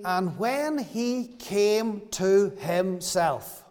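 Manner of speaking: drawn out, each word weighed; slow scripture reading. Acted. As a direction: read the verse very slowly, savouring it. Quoting verse seventeen slowly.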